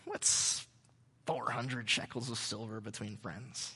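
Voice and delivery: low, soft, slow, half-whispered price; sly seller. Acted. Ephron slyly naming his price to Abraham, low and soft. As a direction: slip the price in sweetly. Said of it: "Ephron is like".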